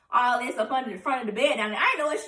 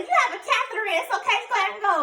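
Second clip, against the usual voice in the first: high-pitched, sing-out reassurance; imitated nurse soothing a patient; nurse coaxing; 11 semitones higher, faster than usual. Nurse explaining catheter, retold.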